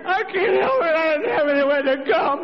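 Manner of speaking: put-on high, desperate old woman's voice; high sing-song begging; old lady pleading.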